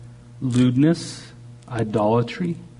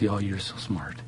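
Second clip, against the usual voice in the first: soft, fond, much quieter; tender affection; 5 semitones lower, 10 dB quieter than usual. Retold or imitated. Preacher voicing God praising the believer, soft and fond. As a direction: coo it softly like a proud father. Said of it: "He goes".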